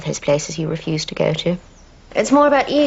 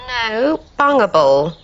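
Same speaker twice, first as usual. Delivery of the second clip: bungable said louder with a rising end; correcting the word; 2 semitones higher, 5 dB louder, much slower than usual. Shown as a demonstration. Wife on the phone corrects Jeffrey, stressing bungable. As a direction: stress bungable to set it against vulnerable.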